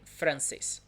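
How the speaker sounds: "Francis" as Portuguese francês, with a vibrating R. Shown as a demonstration. Teacher recapping the nationality word, vibrating R. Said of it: "vibrating, Francis".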